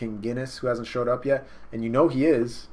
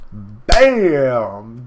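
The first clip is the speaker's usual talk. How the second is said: loud, drawn-out punch sound effect; shouted impact noise; 12 dB louder than usual. Retold or imitated. Narrator voices the right hook landing, very loud impact shout. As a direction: boom it out like a huge blow landing.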